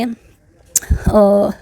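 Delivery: ordinary talk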